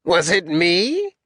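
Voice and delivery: high, drawn-out mocking question; Alexander. General taunts the captain between kicks, high-pitched. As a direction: ask it slowly, climbing high at the end. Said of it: "He has a high-pitched voice".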